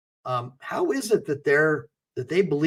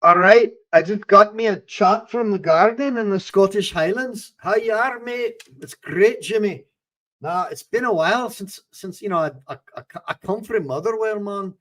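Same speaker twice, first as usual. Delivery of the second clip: put-on Glaswegian accent, higher pitched; 5 semitones higher than usual. Streamer greeting a Scottish chatter, in a put-on Glaswegian accent. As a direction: chat like a Scot from Motherwell in broad Glaswegian. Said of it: "for my Glaswegian accent".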